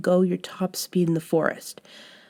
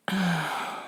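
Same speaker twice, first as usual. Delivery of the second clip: a long, very soft sigh falling away; resigned sigh; 3 semitones lower, 8 dB quieter than usual. Acted. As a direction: let the breath out, giving up the argument. Resigned sigh before giving advice.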